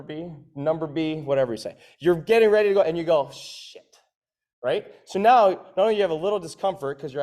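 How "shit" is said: voiceless whispered curse; whispered dread. Acted. Coach acting out getting ready to go, whispered curse.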